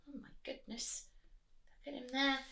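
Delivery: gasped whisper of surprise; whispered shock. Real, not acted. Teacher reacting in a whisper to the thief. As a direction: hush it out, amazed. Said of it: "unvoiced whisper".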